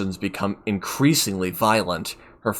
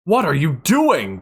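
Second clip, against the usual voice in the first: loud, higher shouted question; 8 semitones higher, 7 dB louder than usual. A narrator's reading. Doctor shouts at Kime holding her hands, outraged shout. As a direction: yell it in outrage. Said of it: "the doctor shouts at him".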